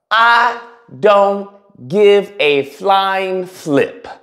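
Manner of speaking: slow dismissal. Real, not acted. Dismissive punchline drawn out.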